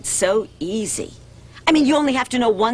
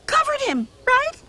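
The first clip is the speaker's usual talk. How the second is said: questioning. Acted. Mother asking, high.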